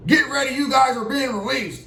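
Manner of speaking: high, brisk officer voice; lightly voiced guard calling out; guard telling the cell they're released, lightly voiced; officer announcing.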